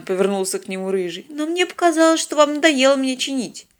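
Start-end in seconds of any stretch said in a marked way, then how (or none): 1.3-3.7 high, meek apology; penitent with irony. A narrator's reading. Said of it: "В покаянном голосе киборга у Станислава Федотовича почувствовалась ирония". (покаянно, с иронией)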